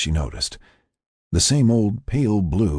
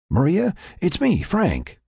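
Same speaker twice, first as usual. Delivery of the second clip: higher-pitched voice reassuring a patient; Frank; 13 semitones higher than usual. Acted. Frank reassures Maria as she wakes, pitched-up voice. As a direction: call to her, pitched up. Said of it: "the voice repeated".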